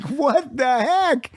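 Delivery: high, slow drawn-out disbelief; baffled. Retold or imitated. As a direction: stretch it out, dumbfounded. Baffled retort in story.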